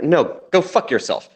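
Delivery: usual speaking voice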